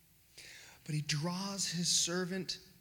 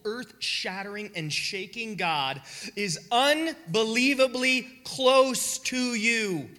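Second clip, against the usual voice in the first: higher and louder, very slow on close to you; soaring preaching climax; 6 semitones higher, 7 dB louder, slower than usual. Real, not acted. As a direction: swell to the peak.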